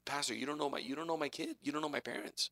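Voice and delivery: put-on hushed confiding teen. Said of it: "young people come to me and tell me".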